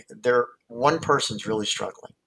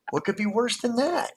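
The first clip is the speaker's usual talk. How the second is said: questioning; 12 semitones higher than usual. Retold or imitated. Retold coaching dialogue questions.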